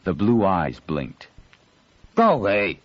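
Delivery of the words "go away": growled. Acted. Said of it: "a young male voice growled at her".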